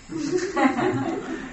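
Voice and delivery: very high, soft dog noises; quick little high-pitched pulses like a dog; imitated dog sound. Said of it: "And the dog is like".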